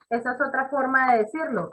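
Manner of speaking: ordinary talk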